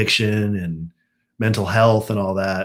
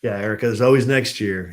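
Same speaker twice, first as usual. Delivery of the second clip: ordinary talk, nothing marked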